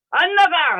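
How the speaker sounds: mimicked high, loud shriek; yelled refusal. Old woman refusing the pastor's church invitation, imitated yelling. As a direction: shout it like a stubborn refuser being imitated. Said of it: "막 소리를 지르면서".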